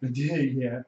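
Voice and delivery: ordinary talk, nothing marked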